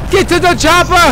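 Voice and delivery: high, loud action-movie yell; shouted catchphrase. Pretend. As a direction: holler it like an urgent escape order. Shouting film catchphrase during escape.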